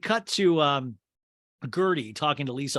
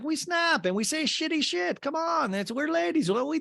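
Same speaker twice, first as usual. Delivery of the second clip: much higher, airy mock voice; mock ladies' voice; 13 semitones higher than usual. Two castmates excusing their snapping, in a high mocking voice. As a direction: play them up high and prissy. Mimicking Marisol and Alexia chatting.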